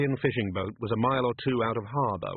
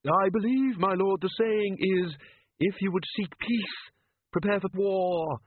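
mild, higher, hesitant inventor; a lightly raised, polite, unsure voice; Leonard's higher voice; 8 semitones higher than usual. A narrator's reading. Leonard speaks up timidly.

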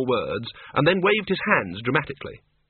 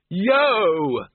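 high bellowed yo; 7 semitones higher, 4 dB louder than usual. A narrator's reading. Ridcully bellows at the crowd from the flying trolley. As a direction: whoop at the scattering crowd. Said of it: "bellowed Ridcully".

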